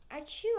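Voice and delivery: loud acted sneeze; big "achoo" sneeze; fake sneeze. Sneezing in story.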